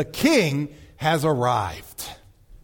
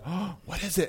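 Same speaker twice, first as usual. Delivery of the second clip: hushed surprise; 9 dB quieter than usual. Retold or imitated. Everyone at the wedding wondering who spoke, hushed surprise. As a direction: murmur it like a startled crowd. Crowd gasps at sudden voice.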